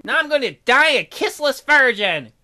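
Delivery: high, loud whining bear; loud lament. Acted. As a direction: wail about your lonely life.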